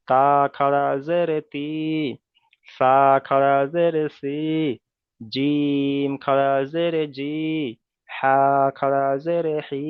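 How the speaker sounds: slow, drawn-out letter-by-letter chant; slow recitation drill. Shown as a demonstration. Teacher modelling the letter line for students, slow chanted recitation. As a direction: recite each letter as a model, stretched slowly. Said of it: "খালা জের লাগিয়ে উচ্চারণ করলে কেমন করে উচ্চারণ করতে হবে".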